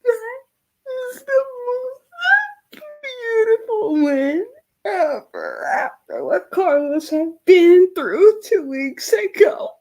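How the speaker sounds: high, choked-up happy sobbing; real crying. Real, not acted. Streamer overwhelmed by Carlos's win, crying. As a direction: overcome with tears, voice lifting high. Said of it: "I'm sorry if I'm crying".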